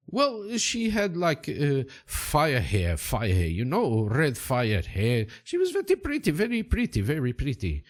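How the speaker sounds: high, lilting, taken-with-her boatman; Valdemar Silva. Acted. Boatman describing a pretty redhead, excitable character voice. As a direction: gush a little in a put-on foreign voice.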